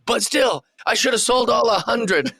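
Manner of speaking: high-pitched mock yell; Kevin Owens. Wrestler back to complaining about merch, imitated yelling. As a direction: jump straight back into the imitated rant. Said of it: "when he's just yelling".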